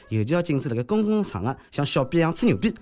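ordinary talk

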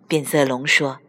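ordinary delivery

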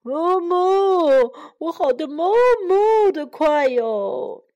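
big drawn-out cow moos; Mary Moo Cow, boisterous. Acted. Cow showing off for the children, boisterous moo.

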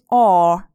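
a long, drawn-out "or" vowel. Shown as a demonstration. Teacher explains the vowel in horse, long "or". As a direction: hold the "or" sound slowly. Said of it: "O R发的是长音".

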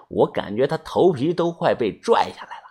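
usual speaking voice

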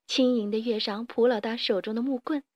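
plain reading voice

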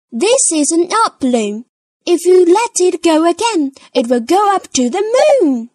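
put-on high, bossy little-girl voice explaining; big sister lecturing her brother; Peppa voice.

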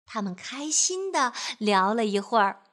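ordinary delivery, nothing marked

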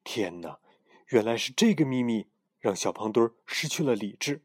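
usual speaking voice

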